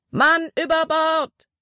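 slow, high shout from across the water; distant shout. A narrator's reading. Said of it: "wurde auf der War Eagle geschrien". (gedehnter, hoher Ruf aus der Ferne)

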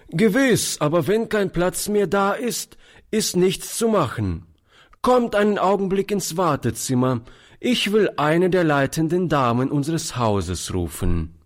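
a lightly voiced stiff porter; officious porter. A narrator's reading. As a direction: brush off the old man by the rules. Porter answers, then relents.

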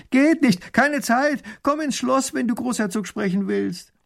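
high, loud dismissive shout; high-pitched hurried call, easing at the end; calling back. A narrator's reading. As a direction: shout back from a moving coach. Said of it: "Erich ruft zurück".

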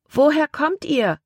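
put-on high-pitched girl's call. A narrator's reading. Girl on shore asking the boat's young passengers, calling out. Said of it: "rief ein Mädchen".

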